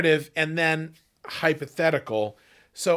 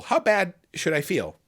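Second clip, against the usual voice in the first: normal talking voice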